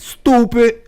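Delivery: exaggerated mocking "stupid"; stooopid. Shown as a demonstration. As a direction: drag "stupid" out high. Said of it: "Now I'm gonna say stupid all night".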